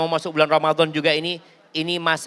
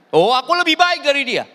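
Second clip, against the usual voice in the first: raised, proud and self-justifying; arrogant Iblis; 7 semitones higher, 4 dB louder than usual. Acted. Iblis answering God, proud and defensive. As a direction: Iblis boasting he is better. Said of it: "Bela diri dia".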